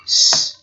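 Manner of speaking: "S" as a lone voiceless hiss; hissed S sound. Shown as a demonstration. Teacher shows learners the ending sound, voiceless S. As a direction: say "S" as just the sss sound. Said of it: "được phát âm là âm S".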